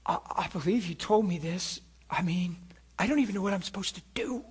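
soft, hushed plea to God; a hint of desperate whisper-prayer; quiet inner prayer. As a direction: pray under your breath, anxious. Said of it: "in my heart, I said".

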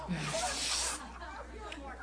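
soft, wheezy high laugh. Real, not acted. Preacher lost his train of thought, wheezy laugh. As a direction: give in to helpless quiet laughter.

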